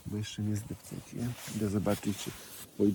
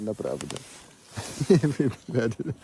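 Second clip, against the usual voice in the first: laughing; 7 dB louder, slower than usual. Real, not acted. Teasing a donkey's rough affection.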